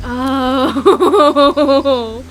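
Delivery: loud, drawn-out laughing "oh". Real, not acted. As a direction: burst out laughing on the word. Bursts into laughter mid-story.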